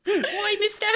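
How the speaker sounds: very high urchin calling out; squeaky waif through giggles; little scamp voice. Pretend. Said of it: "You might wanna use that voice".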